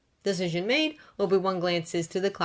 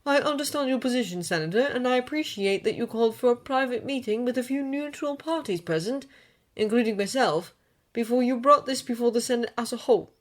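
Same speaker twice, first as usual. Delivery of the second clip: smooth, higher diplomatic voice; 6 semitones higher than usual. A narrator's reading. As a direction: soothe him with polished courtesy. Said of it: "Obi-Wan says smoothly".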